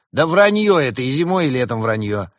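loud, high, flatly dismissive; blunt loud dismissal. A narrator's reading. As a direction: shout it down as lies. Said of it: "выразился более категорично".

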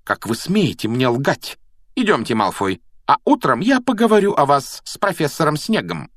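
stern, high-pitched rebuke; indignant. A narrator's reading. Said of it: "возмутилась профессор МакГонагал". (строго и возмущённо, повысив тон)

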